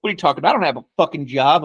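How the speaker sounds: ordinary talk, nothing marked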